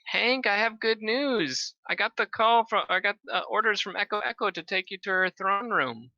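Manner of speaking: Vivian voice. Acted. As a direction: announce it brightly, up high. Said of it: "She goes".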